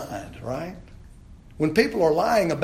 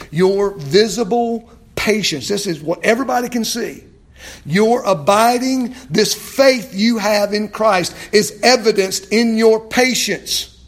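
loud, raised, emphatic preaching; impassioned preaching; 6 semitones higher, 8 dB louder than usual. Real, not acted. Preacher expounding Paul's words on patience, impassioned. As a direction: drive each phrase home forcefully.

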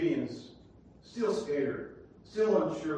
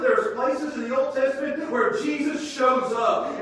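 louder, higher, building fervor; excited preaching; 9 semitones higher, 6 dB louder than usual. Real, not acted. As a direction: lift them with rising excitement.

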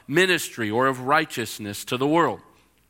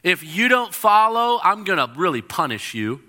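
mock-stern threat, raised in pitch at first; angry father; 5 semitones higher, 4 dB louder than usual. Preacher voicing a misheard angry God to listeners, mock-angry. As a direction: warn like an imagined angry father in heaven. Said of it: "angry Father in heaven saying".

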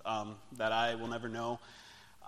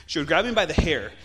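the same words again, much louder; louder repeat; 2 semitones higher, 10 dB louder than usual. Real, not acted. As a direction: push the repeat hard for emphasis. Repeats the line with emphasis.

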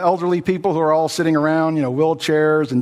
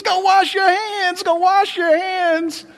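much higher, slow and insistent; fussy nurse; 13 semitones higher, slower than usual. Retold or imitated. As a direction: drag out the order like a squeamish nurse. Nurse ordering after handling nose.

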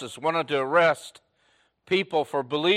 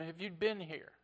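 much softer, hushed reproach; hushed grief; 4 semitones higher, 11 dB quieter than usual. Acted. Martha telling Jesus her brother died, hushed grief. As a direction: barely voice the loss.

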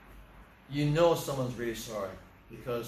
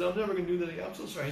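higher-pitched imitation of a crying boy; mock tearful pleading; 6 semitones higher, much faster than usual. Boy swears to the driver he won't repeat it, mock tearful. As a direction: act out a boy's tearful apology, lightly. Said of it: "He's crying".

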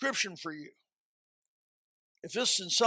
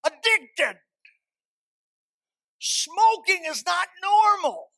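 high, loud sermon delivery; forceful preaching; 6 semitones higher, 4 dB louder than usual. Real, not acted. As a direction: drive the point home to the congregation. Preaching against smoking.

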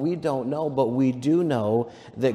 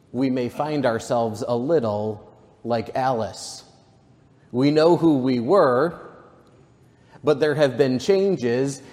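ordinary delivery

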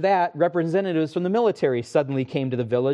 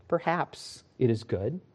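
calm farmer; 3 semitones lower, 6 dB quieter than usual. Acted. Wise farmer replies calmly.

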